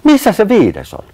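high, loud questioning cry; alarmed shout. A narrator's reading. Sanni looks for the missing otter, alarmed shout. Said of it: "Sanni huudatti".

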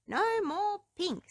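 high, slow, firm little princess; firmest voice. Acted. Princess refusing pink to her father, firm and slow. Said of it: "in her firmest voice".

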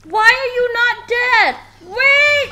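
very high, very loud shriek; shrieking panic; panicked scream. Real, not acted. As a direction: scream in alarm at the thing coming. Enemy attacks her in horror game.

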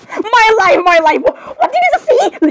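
high outburst rising into a squeak; shocked squeal. Acted.

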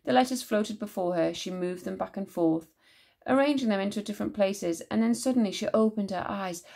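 usual speaking voice

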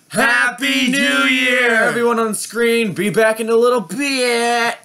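high, loud, stretched-out cheer; celebratory shout. Real, not acted. Host greeting viewers at midnight, celebratory shout. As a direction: holler it out to everyone watching. Countdown ends in New Year shout.

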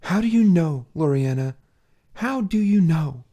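loud, pressing repeated question; desperate demand. Acted. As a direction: demand an answer.

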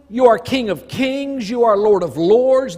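ordinary delivery

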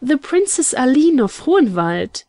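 high, swooping reveal; dramatic announcement. A narrator's reading. Young woman reveals surprise news to Carlton, dramatic announcement. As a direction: announce it for full dramatic effect. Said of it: "pausing for proper dramatic effect".

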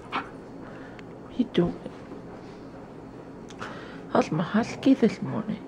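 high cooing question; high pet voice. Real, not acted. Baby talk to dog.

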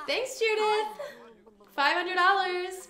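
high, loud laughing thanks; laughing squeal. Real, not acted. Player laughing as Judith lends her money. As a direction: giggle out the gratitude.

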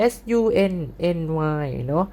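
ordinary talk, nothing marked

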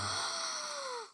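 high dramatic gasp; shocked gasp. Acted. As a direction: gasp in mock outrage. Teammate took her item.

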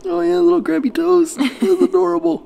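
very high, loud cooing. Real, not acted. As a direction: squeal over tiny toes. Cooing over baby's toes.

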